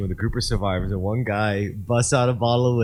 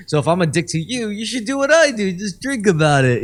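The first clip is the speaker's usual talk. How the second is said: mock pushy drinker; 11 semitones higher, 5 dB louder than usual. Mocking projecting drinker.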